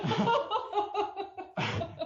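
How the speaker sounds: high burst of laughter; real high laugh. Real, not acted. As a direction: laugh it off after the scare. Laughing after retold scare.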